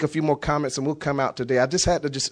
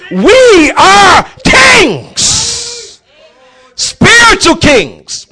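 loud, high shouting sermon; rally the church, hammering every line; fiery pulpit preaching; preaching at full force; 12 semitones higher, 12 dB louder than usual. Acted.

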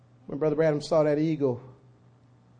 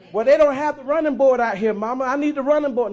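put-on higher, louder childlike plea; young eagle pleading; 6 semitones higher, 9 dB louder than usual. Young eagle pleads with his mama, loud. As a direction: beg mama for help.

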